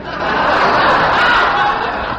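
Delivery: breathy chuckle; hearty laugh. Real, not acted. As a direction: laugh at your own joke. Laughing at his own joke.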